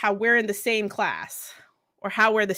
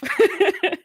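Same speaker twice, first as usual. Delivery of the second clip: high laugh; 10 semitones higher, 4 dB louder than usual. Real, not acted. Laughs again.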